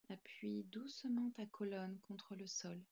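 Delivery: normal talking voice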